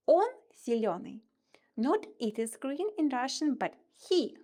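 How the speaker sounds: ordinary talk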